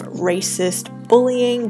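ordinary talk

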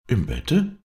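startled exclamation; exclaiming visitor. A narrator's reading. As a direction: call out in high astonishment. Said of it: "rief Frau von Selter".

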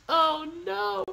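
very high squealed oh no; hammed-up high-pitched dismay; mock dismay. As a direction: play up the horror in a high voice. Mock dismay over the touched peach.

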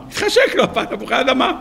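very high, squeaky repeat; amused incredulity. Real, not acted. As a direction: echo it in laughing disbelief, pitch leaping up. Mock disbelief repeating the craving. (תמיהה משועשעת בקול גבוה מאוד)

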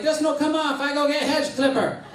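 high-pitched mock foreign accent; caricatured broken-English accent, high and insistent; exotic foreign accent. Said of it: "she had this real exotic accent".

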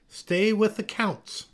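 high, slow, firm correction; teacher's correction. A narrator's reading. Miss Olga to the tree falling behind, firm correction. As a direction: press the instruction home slowly.

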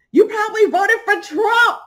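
high, loud, teary shriek; shrieked insult. Retold or imitated. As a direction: screech it like you're losing it.

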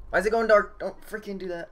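alarmed. Real, not acted. Scared in horror game.

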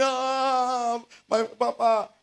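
high, lament-like cry of "Ah, my papa"; mild mock wail. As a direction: echo a grieving woman's cry. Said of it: "she started screaming".

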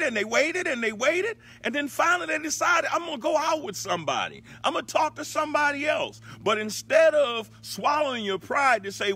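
loud, high, driving preacher's delivery; preaching at full force. Real, not acted. Preacher telling listeners about a couple who broke up, at full force.

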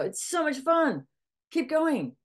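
hammed-up forced enthusiasm; mock cheerful. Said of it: "I go".

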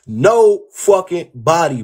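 slow, loud, each word punched; emphatic. Real, not acted. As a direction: drag out no fucking body. Emphatic, drawn out.

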